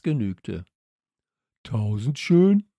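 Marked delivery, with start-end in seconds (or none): 1.6-2.7 loud, drawn-out questioning call; calling up from below. A narrator's reading. Boy calls up to his dog, calling out. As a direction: call up to someone above, stretching the name. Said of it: "tönte es von unten herauf".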